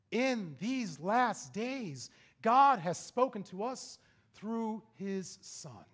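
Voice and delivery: slow pulpit proclamation of scripture; emphatic scripture reading. Real, not acted. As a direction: declare the verse word by word.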